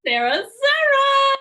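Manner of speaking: high, loud, slow chanting of the name; hammed-up crowd cheer. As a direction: cheer yourself onto the stage. Guest cheers own entrance.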